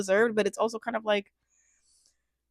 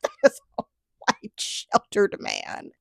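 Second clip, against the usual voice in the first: breathy, tickled, slowing at the end; laughing. Real, not acted. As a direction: voice lost in laughter. Laughing at husband's mistake.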